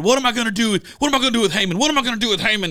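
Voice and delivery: high, agitated mock rant; angry king. Church member acts out the king in Esther, angry. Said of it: "The king's all angry and he's mad".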